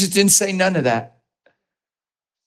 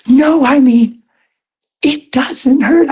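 higher and louder, insisting; insistent exclaim; 8 semitones higher, 3 dB louder, slower than usual. Retold or imitated. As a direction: push the words up, amazed and insistent. Woman insisting, retold.

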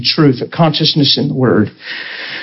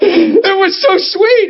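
laughing through tears; much slower than usual. Real, not acted. Moved to tears retelling.

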